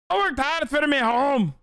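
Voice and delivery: "worked" hit hard, "home" stretched Scottish-style; Scottish accent. Pretend. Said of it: "Is that good?".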